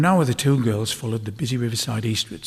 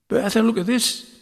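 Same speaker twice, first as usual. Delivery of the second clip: put-on high, bright little girl calling out; a child thrilled by her find; young girl calling her sister, excited; 11 semitones higher, 4 dB louder than usual. A narrator's reading.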